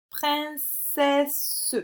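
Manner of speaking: princesse split as prin-ce-sse, each part held; syllable by syllable. Shown as a demonstration. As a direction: say princesse slowly in separate syllables. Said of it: "dans quelle syllabe tu entends le son S".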